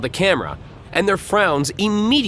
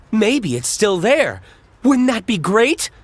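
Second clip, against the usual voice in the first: eager; 7 semitones higher, 4 dB louder than usual. Acted. Reporter eager for disaster.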